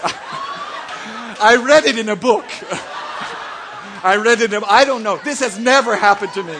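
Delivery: talking through real laughter; laughing. Real, not acted. Preacher denies experience while laughing, laughing. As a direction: giggle while denying it.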